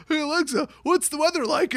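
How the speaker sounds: high-pitched, barely composed question; on the verge of laughter. Real, not acted. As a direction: ask it high, trying not to crack up. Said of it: "cannot even handle this story".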